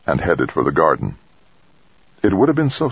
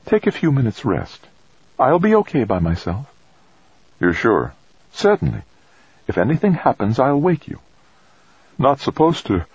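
character dialogue voice; 9 semitones higher than usual. A narrator's reading. Trudy and Tommy dialogue voiced.

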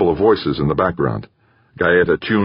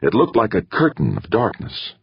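plain reading voice

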